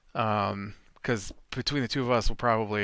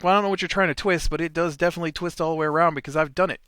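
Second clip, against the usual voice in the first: exasperated retort; 5 semitones higher, 5 dB louder, much faster than usual. Retold or imitated. Retold quarrel under the sink.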